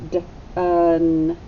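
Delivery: D, un split into its two sounds; sounded out. Shown as a demonstration. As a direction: say D and un as separate sounds. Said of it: "the E is completely silent".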